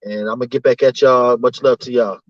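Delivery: normal talking voice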